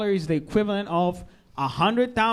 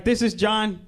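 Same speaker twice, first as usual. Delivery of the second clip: charity ad celebrity; 4 semitones higher than usual. Retold or imitated. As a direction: pitch it like a mock celebrity on a charity ad. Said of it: "celebrities will come out".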